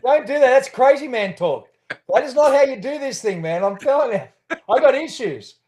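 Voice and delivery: laughing loud warning. Real, not acted.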